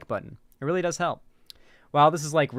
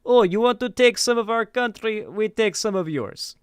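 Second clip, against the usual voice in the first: higher-pitched hammed-up Russian accent, slowing at the end; mock Russian accent; 6 semitones higher than usual. Streamer voicing Russia's reply to chat, mock Russian accent. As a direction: play a smug Russian official in a mock Russian accent. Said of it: "so I'm just gonna do Russian".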